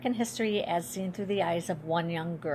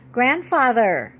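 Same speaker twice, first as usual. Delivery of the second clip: raised, slow, louder call of his name; a bit louder; 4 semitones higher, 6 dB louder, slower than usual. A narrator's reading. Girl waking sleeping great-grandfather, a bit louder. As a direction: wake him with a firmer call. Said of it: "I say a bit louder".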